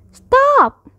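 high, loud one-word cry; shouted order. A narrator's reading. Child trying to halt runaway Bear, shouting. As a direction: shout it high, with a falling end. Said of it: "I shouted".

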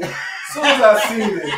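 high, loud laughing complaint; real laughter at the joke. Real, not acted. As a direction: laugh through the words. Laughing at being set up.

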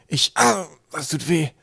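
high, loud cry of pain; pained groan. A narrator's reading. Kaukab gets an elbow in the back, pained groan. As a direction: yelp as if poked hard. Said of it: "stöhnte Kaukab".

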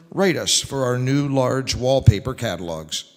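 ordinary delivery

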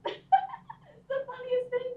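words broken by laughter; delighted at Cornelia's fall; laughing. Real, not acted. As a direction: giggle through it.

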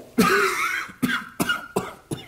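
harsh choking cough fit; asthma cough. Acted. Commissaire seized by asthma, coughing hard.

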